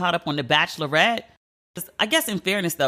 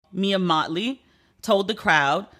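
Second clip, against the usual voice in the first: ordinary talk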